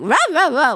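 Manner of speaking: short rough-edged "rah" barks; dog talking back in barks; imitated dog bark. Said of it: "a different kind of bark".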